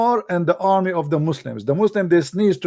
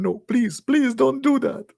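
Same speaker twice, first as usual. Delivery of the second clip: high, whimpering mock plea; comic high-pitched begging; pleading God; 9 semitones higher than usual. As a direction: beg in a squeaky, put-on voice. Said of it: "And Allah saying".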